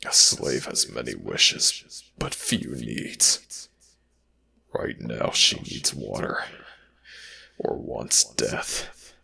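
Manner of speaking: normal talking voice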